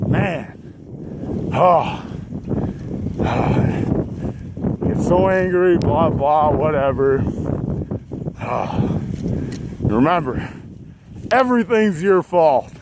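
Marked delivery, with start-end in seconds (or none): 0.9-4.8 a long, drawn-out exhausted exhale; empty your lungs, beaten; long heavy sigh. Real, not acted. Worn-out cyclist after pep talk, long heavy sigh. 7.3-9.8 a long, tired breath out; weary sigh. Real, not acted. Cyclist letting go of anger, weary sigh.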